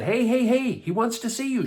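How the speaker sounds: high, loud attention-getting; urgent calling. A narrator's reading. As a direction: get someone's attention in a hurry. Crowd calls to the blind man.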